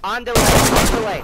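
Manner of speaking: frantic shout. Acted. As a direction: scream it out in alarm. Cop shouts during escape.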